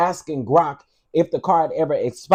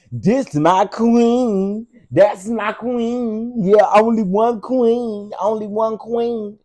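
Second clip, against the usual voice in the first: mock fan gushing; 4 semitones higher, much slower than usual. Mocking fans praising [name].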